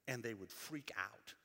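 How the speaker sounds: ordinary talk, nothing marked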